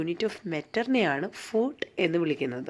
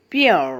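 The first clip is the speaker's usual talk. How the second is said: Pure much louder, falling; the stressed last beat of reappear; stressed syllable; 2 semitones higher, 10 dB louder, slower than usual. Shown as a demonstration. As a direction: punch the pure hard. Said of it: "last portion മാത്രം stress ചെയ്യുക".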